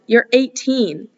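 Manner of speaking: put-on slow, lightly done British accent; a hint of a British drawl; a hint of British accent for Hardin. A narrator's reading. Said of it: "I keep forgetting the accent".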